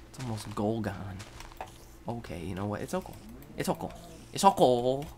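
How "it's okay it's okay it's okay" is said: higher, sing-song reassurance; calm yourself down, building; nervous self-comfort; mock soothing. Reassuring himself, rising pitch.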